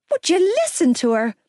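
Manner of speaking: high, indignant outburst. A narrator's reading. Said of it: "Gibbsy demanded".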